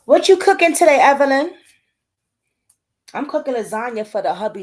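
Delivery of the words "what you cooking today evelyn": loud call-out. Real, not acted. Reads viewer question, calling out.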